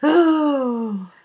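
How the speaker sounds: loud, high drawn-out oh; big exclamation. Real, not acted. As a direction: burst out with a big oh. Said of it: "exaggerated reaction".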